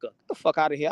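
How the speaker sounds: usual speaking voice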